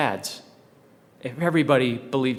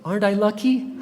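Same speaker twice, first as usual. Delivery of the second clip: light, much higher, teasing little question; playful wife's reply; 8 semitones higher than usual. Retold or imitated. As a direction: toss it off like a delighted wife. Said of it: "Sister Faust went".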